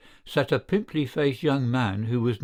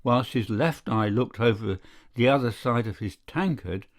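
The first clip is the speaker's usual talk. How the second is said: ordinary delivery, nothing marked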